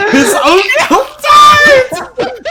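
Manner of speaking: cracking up mid-sentence; words squeezed out through helpless laughter; laughing. Real, not acted. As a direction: breaks into a high, loud laugh while reading. Reading story, bursts out laughing.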